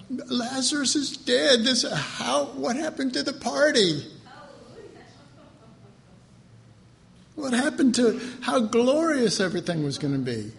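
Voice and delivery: high, upset, accusing voice; distraught complaint. Acted. Martha and Mary reproaching Jesus, high and upset. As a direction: plead and accuse all at once.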